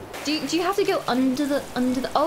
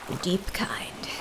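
hushed secret whisper; 5 semitones lower, 7 dB quieter, slower than usual. Pretend. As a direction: breathe it like a secret. Whispered aside about deep truth.

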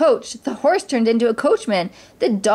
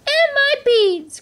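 very high, drawn-out wail; outraged cry; slower than usual. A narrator's reading. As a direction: stretch it into an indignant squeal. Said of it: "cried Anastasia".

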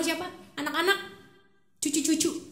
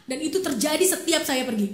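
normal talking voice

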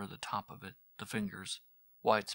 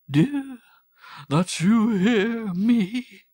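much louder and higher, slow desperate plea; anguished cry; 13 semitones higher, 17 dB louder, much slower than usual. Acted. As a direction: cry out to be heard. Mother begs heaven loudly.

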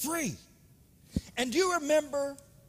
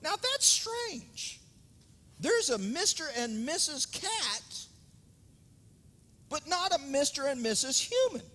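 puzzled Adam; 6 semitones higher than usual. Acted. Preacher voicing Adam pondering the paired animals, puzzled. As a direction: wonder aloud, stretching the thought. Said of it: "Adam starts to think to himself".

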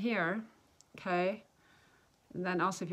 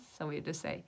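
ordinary talk

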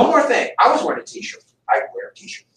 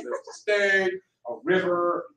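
ordinary delivery

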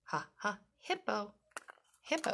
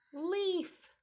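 gasp of delight; 12 semitones higher than usual. Acted. Gasping delight at a leaf.

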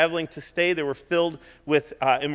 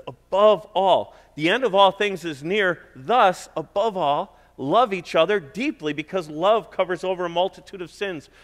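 preaching scripture; 4 semitones higher, 4 dB louder than usual. Acted. Pastor reading the verse to his church, full preaching force. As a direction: proclaim it to the congregation.

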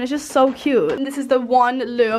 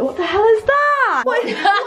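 surprised laughing shout; 10 semitones higher than usual. Real, not acted. Shocked at friends' state.

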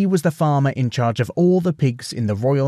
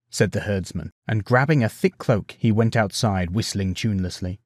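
usual speaking voice

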